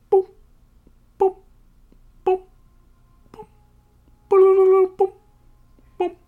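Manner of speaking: short high boops, evenly spaced; tiny high-pitched electronic blips; radar blip imitation. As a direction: mimic a radar beep with pauses between.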